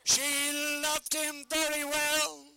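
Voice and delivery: slow, drawn-out ringmaster call; booming barker call. Shown as a demonstration. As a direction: belt it slowly to the crowd.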